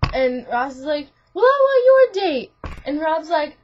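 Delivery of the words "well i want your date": much higher and louder comeback; mock demanding retort. Ross retorts to Tony over dates, mock demanding. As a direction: fire back in an exaggerated squabble.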